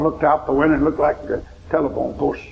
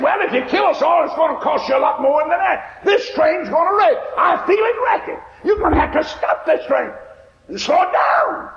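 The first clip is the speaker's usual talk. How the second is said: hysterical shouting; 12 semitones higher than usual. Retold or imitated. Old woman shouting at the flagman, hysterical. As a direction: yell it like the train is crashing. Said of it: "She got frantic and hysterical".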